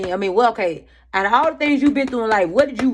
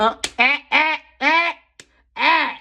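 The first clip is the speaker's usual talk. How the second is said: scolding grunts; 7 semitones higher, 4 dB louder than usual. Acted. Winding up to scold herself.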